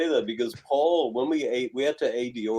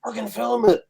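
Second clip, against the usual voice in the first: high-pitched, insistent mimicry; mock urgent, repeated call; imitated urgent command; 9 semitones higher, 6 dB louder than usual. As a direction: act out an urgent order to film. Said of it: "and Paul goes".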